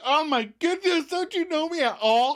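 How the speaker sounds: very high, hammed-up indignant whine; Monica's sneer. Girlfriend snaps at boyfriend's reassurance, mock sneering. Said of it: "And Monica sneers".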